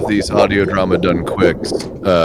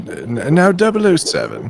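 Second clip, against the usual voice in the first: put-on posh British accent; 10 semitones higher than usual. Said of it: "the exceedingly posh accent that".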